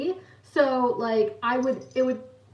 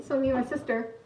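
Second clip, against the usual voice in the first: normal talking voice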